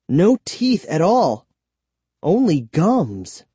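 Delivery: ordinary delivery, nothing marked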